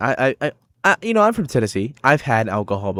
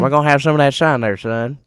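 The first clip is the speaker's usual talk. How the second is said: put-on country accent; 4 semitones higher, 5 dB louder than usual. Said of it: "how every country person sounds".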